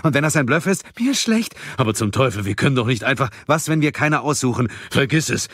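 high, loud, agitated voices; urgent overlapping-style outbursts of panic; panicked group arguing. Acted. Friends forced to choose who dies, panicked.

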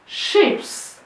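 usual speaking voice

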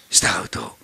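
breathy whispered emphasis; hushed aside to the congregation. Real, not acted. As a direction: drop to a whisper to draw them in. Preacher drops to whisper for emphasis.

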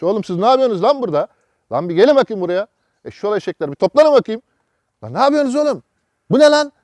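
mock boss yelling at workers, rising to a shout; pretend scolding. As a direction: chew out the lazy shelter staff. Imagined scolding of shelter workers.

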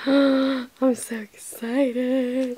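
gasped, breathless whisper; excited whisper. Real, not acted. Opening a PR package, excited gasping whisper. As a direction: whisper it like a secret thrill.

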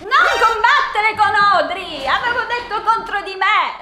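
loud, high telling-off; sharp loud scolding of a pet; scolding the cat. Real, not acted. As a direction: shout at the naughty cat to stop. Scolding the cat fighting Audrey.

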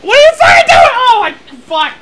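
very high, loud yelling; exasperated shout. Real, not acted. Player to blundering friend, exasperated shout. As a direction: yell in disbelief at the blunder.

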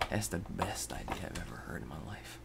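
mock praise under the breath; deadpan whispered dig at the chat; sarcastic whisper. Sarcastic aside to viewers.

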